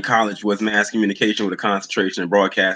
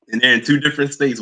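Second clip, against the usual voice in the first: ordinary talk, nothing marked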